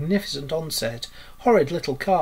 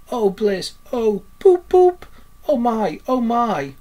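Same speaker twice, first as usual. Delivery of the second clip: high, raised, swooning delivery; dreamy rapture; 5 semitones higher, 5 dB louder than usual. A narrator's reading. Toad raves about travel by motor car, dreamily ecstatic. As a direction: gush as if entranced by a vision. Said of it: "in a dreamy monotone".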